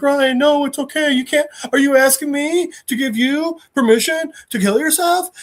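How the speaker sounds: high-pitched imitation of a friend pleading; alarmed pleading. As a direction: act out urgent, disbelieving protest. Friend begs him not to jump.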